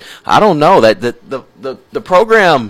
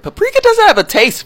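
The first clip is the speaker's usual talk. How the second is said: incredulous high squeal. Real, not acted. Host insisting to the audience that paprika has no taste, high incredulous squeal. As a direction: squeal it at the listeners in disbelief.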